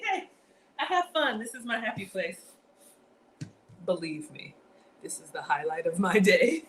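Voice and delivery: ordinary talk, nothing marked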